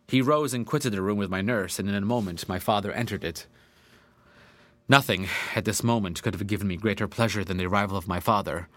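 ordinary delivery, nothing marked

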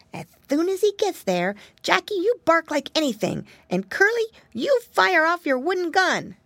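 a small leader giving orders; little pig Flop, commanding. Acted. Flop lays out plan.

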